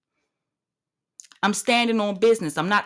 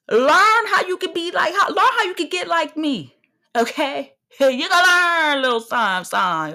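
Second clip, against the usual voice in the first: sassy teaching; 6 semitones higher than usual. Real, not acted. Sassy lesson.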